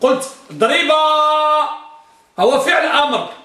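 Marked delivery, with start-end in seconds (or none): 0.0-1.7 ضربا drawn out slowly and higher; stressed example. Shown as a demonstration. Teacher stresses ضربا to show the missing nun. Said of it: "حذفت النون".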